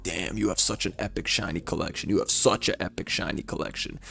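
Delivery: very soft, low marvelling voice; hushed mock admiration. Cousin marvelling at the shinies, hushed imitation. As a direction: murmur it in awe, imitating an admirer.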